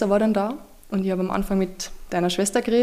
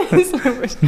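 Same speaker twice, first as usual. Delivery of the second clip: laughing it off, higher; lachend; 5 semitones higher, 5 dB louder than usual. Real, not acted. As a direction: shrug it off with a giggle. Laughs off her mistake. (lachend abgewinkt)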